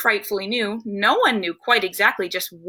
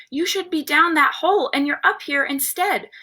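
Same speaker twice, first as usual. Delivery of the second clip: lightly voiced scolding, pitched higher; scolding grandfather; 6 semitones higher than usual. A narrator's reading. Grandfather catching the child above ground, mild scolding. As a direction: tell the child off in a raised storybook voice.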